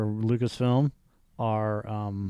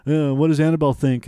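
mocking believers; 4 semitones higher, 6 dB louder than usual.

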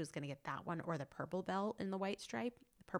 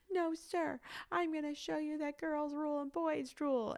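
put-on high, wailed child's defiance; imitating crying child; 10 semitones higher, 3 dB louder than usual. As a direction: mimic a kid answering through tears. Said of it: "she's, like, crying".

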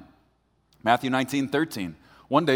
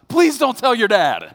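high, loud panicked pleading with a laugh; panicked plea; 10 semitones higher, 8 dB louder than usual. Retold or imitated. Newlywed husband begging injured wife, panicked high plea. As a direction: beg in a rush of panic. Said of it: "all I heard myself say".

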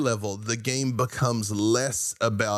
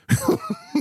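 a quick high burst of real laughter; 3 dB louder than usual. Real, not acted. Laughing at the host's joke about heartbreaking beauty.

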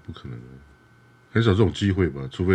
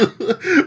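high laugh; 3 dB louder than usual. Real, not acted. Laughs after impression.

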